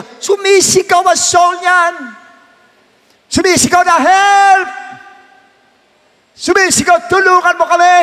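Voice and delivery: high, loud desperate plea for help; pleading cry. Pretend. Preacher voicing souls crying out for help, high and loud. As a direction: cry out like a drowning soul.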